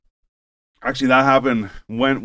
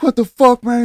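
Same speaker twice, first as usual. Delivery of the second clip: high, loud, incredulous outburst; mock outraged yell; 13 semitones higher, 7 dB louder than usual. Mister Jones comes out to missing limo, high outraged yell. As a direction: imitate a man exploding in disbelief. Retold boss finding limo gone.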